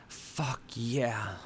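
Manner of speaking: long, drawn moan. A narrator's reading. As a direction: moan the words out. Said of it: "I moaned".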